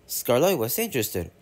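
plain reading voice

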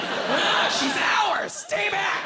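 guard her like King Kong; protective shout. Acted. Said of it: "They're like".

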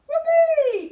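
very high, very loud whoopee; joyful whoop. A narrator's reading. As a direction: let rip a gleeful cheer. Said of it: "cry all the monsters".

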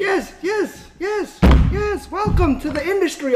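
high put-on voice, sarcastic; mock agreement. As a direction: parrot it back high and mocking.

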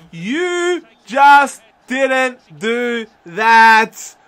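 very slow, loud, word by word; drawn-out, emphatic astonishment; stunned disbelief. Real, not acted. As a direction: stretch out every word in amazement. Stunned by the goal.

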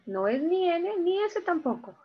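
ordinary delivery

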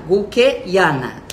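Guiana drawn out slowly as Guqueiana; mock wrong pronunciation. Shown as a demonstration. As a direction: slowly mispronounce Guiana with an added "quei". (Guiana errada e arrastada, "Guqueiana")

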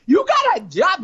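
can't believe he has a job; surprised friend. Acted. Friend disbelieving.

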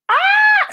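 high, loud squeal of joy; excited squeal. Real, not acted. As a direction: shriek at the sight of the book. Reacting to seeing her book.

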